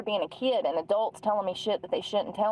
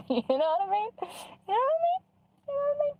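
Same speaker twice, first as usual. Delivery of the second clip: mock squeaky voice; much faster than usual. Vlogger teasing her viewers, squeaking each line higher.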